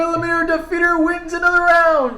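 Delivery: high booming announcer call. Pretend. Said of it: "speaker on the side saying".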